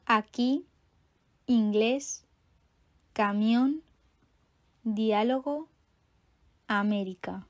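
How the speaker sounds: "Aquí, inglés, camión, diálogo, América" each stressed on its accented vowel; accent tonique écrit. Shown as a demonstration. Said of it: "vont porter un accent écrit". (« Aquí, inglés, camión, diálogo, América » accentués sur la voyelle accentuée)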